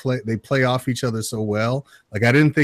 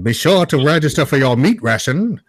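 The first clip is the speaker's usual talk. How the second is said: put-on higher, louder old radio ad parody; mock wartime advert; 6 semitones higher, 5 dB louder than usual. Co-host joking about war bond commercials, mock radio announcer. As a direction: sell it like a hammed-up wartime announcer.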